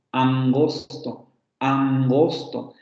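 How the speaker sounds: angosto drawn out slowly, velar nasal before g; slow demo word. Shown as a demonstration. Teacher slowly demonstrating velar nasal in angosto. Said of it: "Nasal velar como en angosto".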